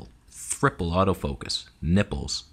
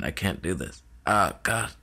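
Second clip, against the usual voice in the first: mock pain; slower than usual. Mock wrist pain vertical.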